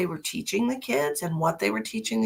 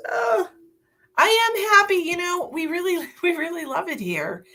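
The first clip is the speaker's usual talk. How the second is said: excited; 12 semitones higher, 4 dB louder than usual. Real, not acted. Gushing about happy new home.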